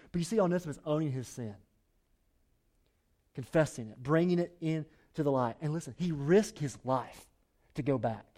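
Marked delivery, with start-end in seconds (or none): none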